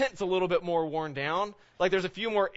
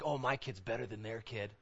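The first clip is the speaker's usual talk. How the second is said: prideful boast; 5 semitones lower, 8 dB quieter than usual. Acted. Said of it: "It's our pride that goes like".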